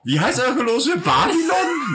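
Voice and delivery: squeaky high shocked question; high mock voice. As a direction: shriek it in mock outrage.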